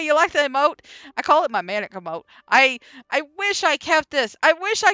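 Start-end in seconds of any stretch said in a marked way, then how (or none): none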